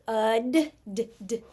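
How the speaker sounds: UD, then bare D sounds tapped out separately; sounding out. Shown as a demonstration. Teacher spelling a syllable sound by sound, segmented. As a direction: say UD and isolate its final D sound. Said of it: "when I'm stretching through it".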